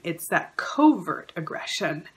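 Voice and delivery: usual speaking voice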